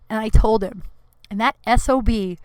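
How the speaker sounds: ordinary delivery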